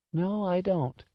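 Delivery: put-on high, hushed old-lady admission; subdued elderly woman; sorrowful old woman. A narrator's reading. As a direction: admit it gently, pitched high. Old woman admits having no solace.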